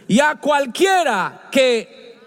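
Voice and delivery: slow, high cry from the pulpit; preacher hitting the verse with a slow shout; preaching shout. Real, not acted. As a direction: draw the words out in a rising shout.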